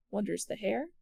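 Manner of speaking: plain reading voice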